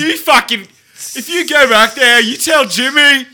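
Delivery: high-pitched shouted Aussie threat; Australian accent. Acted. Local sending a threat to Jimmy, loud Australian accent. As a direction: bellow it in a hammed-up Australian accent. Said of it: "I love doing my Australian accent".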